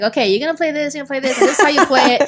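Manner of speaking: retelling herself briskly directing the band; brisk retold instructions. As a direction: rattle off instructions to your bandmates. Retelling bossing her bandmates around.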